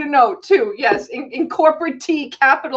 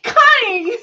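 laughing outcry; 11 semitones higher, 4 dB louder than usual. Real, not acted.

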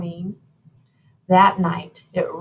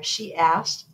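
ordinary delivery

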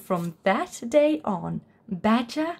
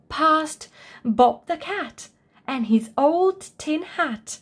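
ordinary delivery

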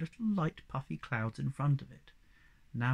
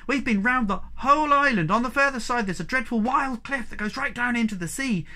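high, loud, eager report; excited cry; 9 semitones higher, 6 dB louder than usual. A narrator's reading. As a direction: tell your big news excitedly. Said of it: "cried Moomin Troll".

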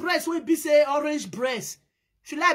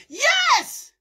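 high, loud-pitched "yes"; excited yell; 13 semitones higher, 4 dB louder than usual. Acted. Commenter reacting to the scene, excited yell. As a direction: shriek it out in sudden excitement.

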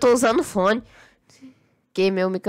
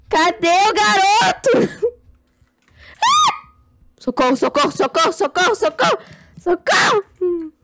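panicked screaming for help; 8 semitones higher, 5 dB louder than usual. Real, not acted. Chased in horror game.

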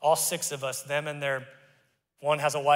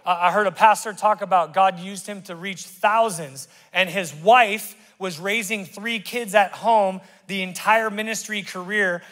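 ordinary talk, nothing marked

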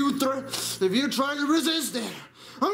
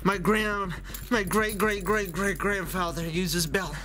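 much lower, tired dad voice; low weary father; 6 semitones lower than usual. Acted. Tired father complaining about his son, low weary voice. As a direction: sag under the exhaustion.

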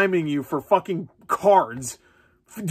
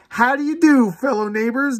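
mock stranger greeting; 8 semitones higher than usual. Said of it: "joke greeting".

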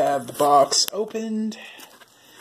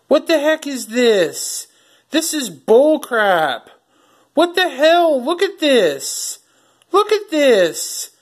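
rising outraged disbelief, pitch climbing high and loud; genuinely fed up, let the outrage spill over; real anger; 6 semitones higher, 4 dB louder than usual. Real, not acted. Finds the disc scratched up.

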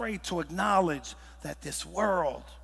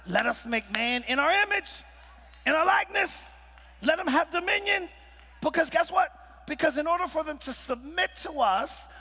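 shouted preaching; 6 semitones higher than usual. Acted. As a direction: shout God's words to the whole church. Preacher peaks on God's words.

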